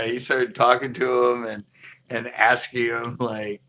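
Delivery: ordinary talk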